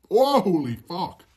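loud, high, stretched-out shock; startled outburst. Real, not acted. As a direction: cry out as if caught off guard. Startled by a crash in game.

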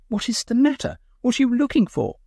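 high, alarmed questions; jolted awake and demanding; startled. A narrator's reading. Woken Waldo startled at an intruder, high and alarmed.

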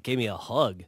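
ordinary talk

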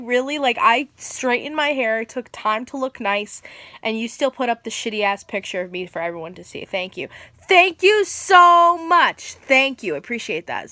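sarcastic rant. Retold or imitated. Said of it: "I'm just like".

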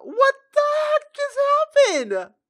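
high, loud, tearful disbelief; crying out in shock. Real, not acted. Gamer reacts to sudden Sim death, crying out. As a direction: wail it out in real distress.

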